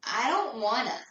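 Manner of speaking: high, loud whiny complaint. Retold or imitated. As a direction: whine it like a sulky kid. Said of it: "in my best whiny voice".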